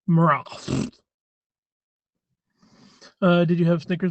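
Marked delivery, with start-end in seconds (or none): 0.1-0.9 Marath said with a rolled-out second syllable; joke pronunciation. Shown as a demonstration. Streamer joking his name [name] is said Marath. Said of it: "but it's pronounced".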